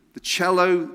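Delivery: ordinary talk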